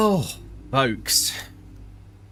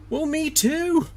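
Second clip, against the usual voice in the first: high mock agreement; slower than usual. Joking reply to chat.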